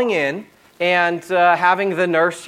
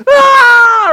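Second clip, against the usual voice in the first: sudden high piercing wail, much louder; imitated baby scream; 13 dB louder than usual. Dad imitating his baby son's scream at the shots. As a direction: cry out like a baby jabbed by a needle. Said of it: "he just starts screaming and crying".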